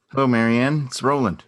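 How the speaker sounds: bursting in with a big hello; a loud hello that catches her off guard; loud greeting. Acted.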